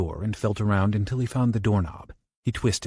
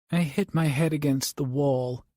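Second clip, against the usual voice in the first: put-on tired, young; 5 semitones higher than usual. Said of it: "sounding tired and very young".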